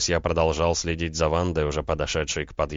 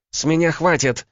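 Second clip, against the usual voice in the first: high, loud fed-up outburst; spat out; 11 semitones higher, 5 dB louder than usual. A narrator's reading. Woman who has had enough of him, spitting anger. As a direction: end it angrily, voice well up. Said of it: "выплюнула она".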